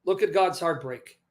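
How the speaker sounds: ordinary delivery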